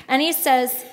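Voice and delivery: ordinary talk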